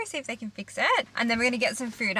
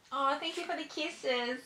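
soft, higher cooing to the dog; softer, higher sing-song pet voice; 4 semitones higher, 7 dB quieter than usual. Real, not acted. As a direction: gush over the puppy's kisses, softly. Cooing to her dog Franklin.

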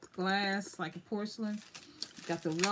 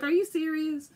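disbelief; 8 semitones higher, 5 dB louder than usual. Retold or imitated. Disbelief at the price.

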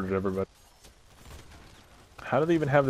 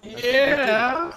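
triumphant cheer; 6 dB louder than usual. Real, not acted. Celebrating after the fight is won.

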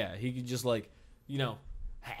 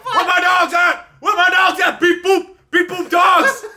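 angry robot [name]; 13 semitones higher, 10 dB louder than usual. Retold or imitated. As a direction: bark it like a gangster droid. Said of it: "really angry robot D M X".

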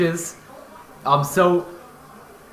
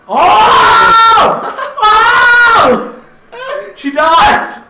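high, loud shocked cry; excited shouting; 8 dB louder, much slower than usual. Real, not acted. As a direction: shout with laughter at the death. Shocked at character death.

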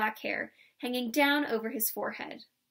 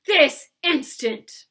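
much louder, higher roared command; loud demand; 6 semitones higher, 10 dB louder, much slower than usual. A narrator's reading. Laban shaking the old man, loud demand. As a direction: roar the demand. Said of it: "he demanded".

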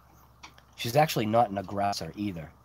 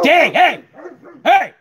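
loud, high sudden yell at the dogs; loud, high startled shout; real shout; 15 dB louder, slower than usual. Real, not acted. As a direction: yell to stop the dogs barking. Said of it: "I'm screaming".

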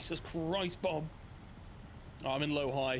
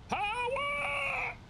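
hyped shout. Acted. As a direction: belt the word out in hype. Hyped shout while driving.

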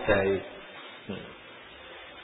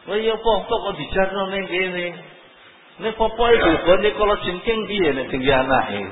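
angry scolding; 6 semitones higher, 3 dB louder than usual. Acted. Scolding parent acted out.

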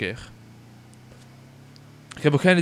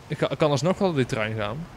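ordinary delivery

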